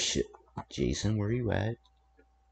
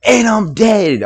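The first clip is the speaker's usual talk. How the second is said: yelling; 7 semitones higher, 11 dB louder than usual. Real, not acted. Chaos in game, yelling.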